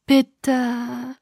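pleading. A narrator's reading. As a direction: beg him gently. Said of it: "fügte ich flehend hinzu".

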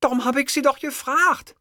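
very high, sniffly secretary voice; sniffling. A narrator's reading. Secretary scolded by her boss, sniffling. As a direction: protest tearfully in a high voice. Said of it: "schniefte Fräulein Krömeier".

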